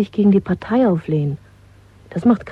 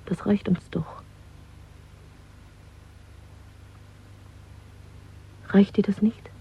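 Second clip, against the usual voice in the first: softer, tender whisper; 12 dB quieter than usual. Acted. Woman pleading with Winston in a soft whisper. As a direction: barely breathe the question to him. Intimate plea to her lover.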